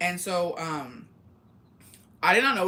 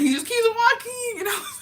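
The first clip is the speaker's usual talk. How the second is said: very high, loud laughing; giggling can't hold it in; laughing through words; 6 dB louder than usual. Real, not acted. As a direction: squeak it out laughing. Laughing while retelling.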